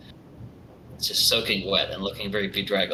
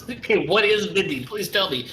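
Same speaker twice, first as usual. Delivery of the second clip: frantic pleading; 8 semitones higher, 4 dB louder than usual. Acted. NPC pleading high.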